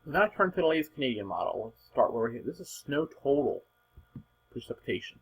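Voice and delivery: ordinary talk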